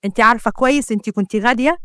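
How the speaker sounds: ordinary delivery, nothing marked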